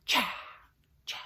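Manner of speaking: breathy, voiceless "cha" with a long airy tail; beatboxed exhale. Shown as a demonstration. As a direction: hit the ch and let the breath rush out. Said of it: "sounds like you're saying, like, cha".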